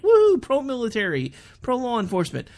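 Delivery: high, loud woohoo of support; mock cheering. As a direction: imitate an enthusiastic crowd cheering. Imitating cheering fellow Oklahomans.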